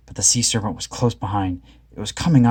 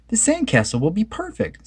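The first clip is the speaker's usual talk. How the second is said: higher Velma voice; 11 semitones higher than usual. A narrator's reading. Velma promising the gang a perfect castle, high voice. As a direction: Velma sure of herself. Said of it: "said Velma".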